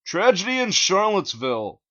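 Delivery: high, loud announcer voice; hammed-up news reporter. A narrator's reading. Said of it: "he announced".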